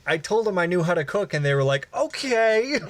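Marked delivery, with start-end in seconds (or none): 1.9-2.8 high, rising, drawn-out okay; mock doubtful okay. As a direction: imitate someone humouring you, pitched way up. Mimicking doubtful staff response.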